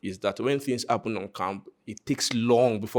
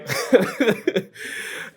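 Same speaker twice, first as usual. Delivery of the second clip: breathy laugh; 12 semitones higher, 4 dB louder than usual. Real, not acted. Laughs at own joke.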